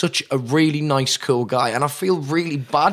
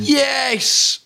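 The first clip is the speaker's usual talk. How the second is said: high, drawn-out yes; excited cheer; 12 semitones higher than usual. Real, not acted. Cheers Bill and Ted news.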